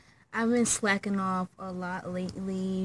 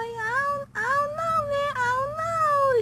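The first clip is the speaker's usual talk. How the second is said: high-pitched whining; 4 dB louder than usual. Retold or imitated. Storyteller mimicking herself unsure about dating a crush, high whine. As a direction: squeal it like an exaggerated wail of indecision. Acting out her girlish hesitation over crush.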